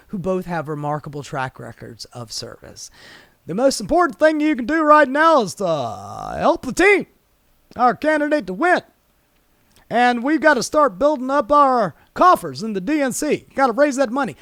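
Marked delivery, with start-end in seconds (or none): 3.5-14.4 higher, louder, sugary pitch of a party insider; hammed-up campaign-speech impression; mock party fundraiser voice. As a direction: parody the establishment begging for donations. Mocking the Democratic fundraising pitch.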